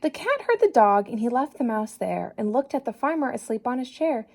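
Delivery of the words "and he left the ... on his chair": plain reading voice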